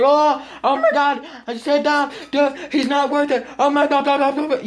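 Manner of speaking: high, frantic mock panic of the kids; panicked bystanders. Imitating kids on the bus freaking out over the fight. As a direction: squeal it like a scared crowd. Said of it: "Everybody's like".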